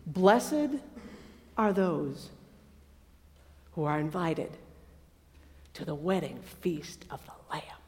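low, hushed, broken by long pauses; slow dictation. Acted. Angel dictating to John to write, slow and hushed. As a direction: dictate phrase by phrase, fading to a whisper. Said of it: "I'm gonna say it slowly".

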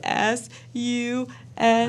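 high, slow drawn-out letters; tearful spelling. Retold or imitated. Crying wife tattooing letter by letter, tearful. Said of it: "I'm just sitting there with tears".

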